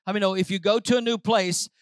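ordinary talk, nothing marked

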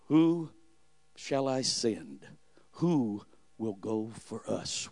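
low, slow and soft divine question; voice of God. Acted. Preacher voicing the Lord asking whom to send, low and slow.

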